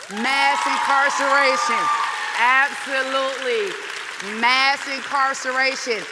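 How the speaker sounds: loud, slow, hammered slogan; emphatic shout. Real, not acted. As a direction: drive each word home to the crowd. Rally speech, crowd response.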